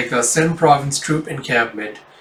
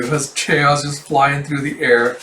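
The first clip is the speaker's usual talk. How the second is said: ordinary talk, nothing marked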